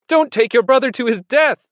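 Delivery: high, loud, desperate appeal; pleading shout. A narrator's reading. Animals begging the horses to stop, shouting. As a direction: beg at the top of the voice. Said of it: "they shouted".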